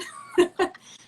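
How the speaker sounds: breathy amused laugh. Real, not acted. Laugh after quote.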